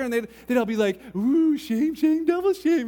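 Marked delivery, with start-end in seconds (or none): 1.2-2.9 put-on high, loud singsong kids' teasing; schoolyard taunt. Kids teasing him about the girl, loud singsong taunt. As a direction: chant it like mocking classmates.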